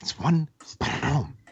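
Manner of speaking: bad Mexican accent with a rolled tongue; imitated Mexican accent. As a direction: repeat his halting English in a mock Mexican accent. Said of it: "it's what he sounded like".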